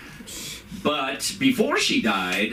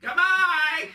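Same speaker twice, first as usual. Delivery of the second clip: much higher crazy clown calling out goodbye; much slower than usual. Retold or imitated. Comic imitating his mother as a crazy clown calling goodbye. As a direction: sing it out like a mad funhouse clown. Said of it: "a crazy clown who would call out".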